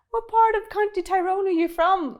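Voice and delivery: high-pitched retold question; imitated eager recognition. Retelling a Scottish man spotting her accent, high eager question. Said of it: "he straight away is like".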